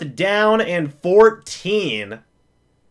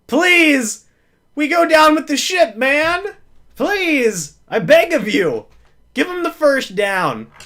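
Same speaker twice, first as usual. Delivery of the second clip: high, pleading voice; begging the refs; 6 semitones higher than usual. Real, not acted. Fan begging the refs for the call, high and pleading. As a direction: plead with the refs, almost praying.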